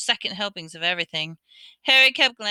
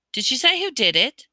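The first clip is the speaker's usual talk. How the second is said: plain reading voice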